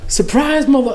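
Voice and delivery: high, hammed-up "surprise"; playful retold gotcha; mock surprise reveal. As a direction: sing out the surprise like a prankster. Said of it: "he was like".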